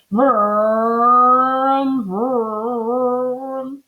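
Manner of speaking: long, drawn-out engine vrooms; dreaming car noise. A narrator's reading. Mister Watson driving fast in his dream, revving vrooms. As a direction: rev the words like a car. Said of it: "said Mister Watson in his sleep".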